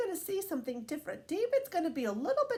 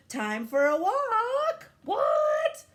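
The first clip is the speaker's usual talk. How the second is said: high, loud, stretched disbelief; can't believe the dog wants out again; exasperated owner cries out; incredulous cry; 10 semitones higher, 6 dB louder, much slower than usual. Acted. Grown-up disbelief, dog wants walk.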